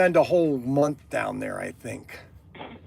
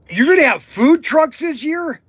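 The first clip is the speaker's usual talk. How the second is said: excited surprise; 8 semitones higher, 4 dB louder than usual. Acted. Gasps at news of food trucks.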